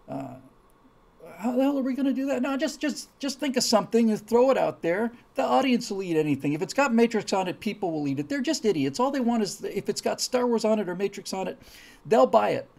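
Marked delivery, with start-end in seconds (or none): 1.4-12.7 high, hurried mock-executive rant; dismissive pitch meeting, voice pushed up and loud; cynical movie producer. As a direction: play a producer scoffing at the audience. Acts out cynical movie producers scheming.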